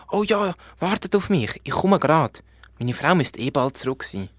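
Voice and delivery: a hurried drake calling after them; eager drake. Acted. (eifriger Enterich, höher und lauter)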